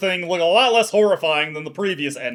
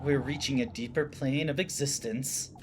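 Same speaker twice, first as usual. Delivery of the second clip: lower, softer entranced voice; soft eerie lure; 3 semitones lower, 8 dB quieter than usual. Acted. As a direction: hush her into the embrace. Rosemarine luring softly.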